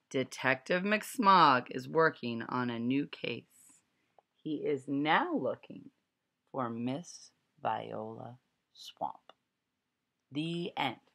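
plain reading voice

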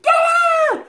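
very high, loud parrot screech; imitated bird scream. Storyteller imitates the screaming bird, shrieked squawk. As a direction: squawk it out at full pitch. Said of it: "he's screaming and he's like".

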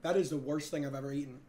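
fast, pained words, tongue on fire; rushing through a burning mouth; mouth burning from spicy food. Real, not acted. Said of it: "My mouth is burning".

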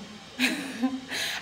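short breathy laugh of disbelief; disbelieving laugh. Real, not acted. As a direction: let out a small voiceless laugh. Disbelief at finding nothing on rooftop.

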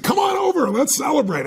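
hammed-up delighted friend calling you over; retold friend's cheerful invitation; excited invite. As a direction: voice leaps high and loud, eager.

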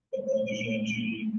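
slow, echoing, delayed copy of the voice; cathedral echo. Real, not acted. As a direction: voice bouncing back from a big hall. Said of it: "tá com eco".

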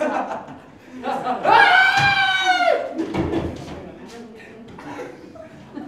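long shrieking laugh; loud high laugh. Acted. Laughing fit at own joke.

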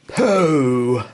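ordinary delivery, nothing marked

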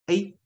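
teacher's high, mid-open "A"; half-open vowel demo. Shown as a demonstration. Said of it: "是比I张口再小，比一大的那个".

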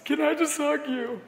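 pleading son. Retold or imitated. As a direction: plead like a broken son asking for a hug. Son breaks and cries at door.

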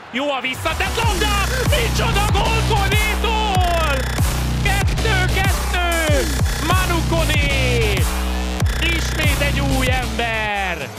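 high, loud, drawn-out goal call; excited hungarian sports commentary. Acted. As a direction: roar the goal like a stadium commentator. Equaliser goal, commentator erupts.

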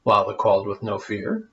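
lively rhyming storybook reading; story narration. A narrator's reading. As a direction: read it like a bedtime tale for kids. Reads the rhyming tale aloud.

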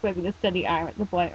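ordinary delivery, nothing marked

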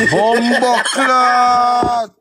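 very slow, loud Bumbo clot! through a laugh; laughing exclamation. Real, not acted. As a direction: stretch it out while laughing.

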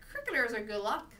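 mocking, sceptical echo, rising; dry disbelief. A narrator's reading. Mother doubts crickets bring luck, dry sarcasm. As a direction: repeat the claim as if you don't buy it. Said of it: "sounding very dry when she didn't believe".

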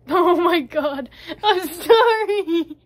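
loud laughing apology. Real, not acted. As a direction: laugh through it, high.

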